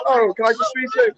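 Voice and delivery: ordinary delivery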